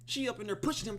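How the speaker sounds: ordinary delivery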